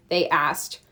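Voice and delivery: plain reading voice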